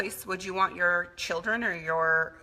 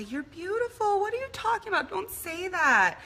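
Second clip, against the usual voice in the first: squeaky put-on mom voice insisting; imitating mom; 12 semitones higher than usual. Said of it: "And my mom would say".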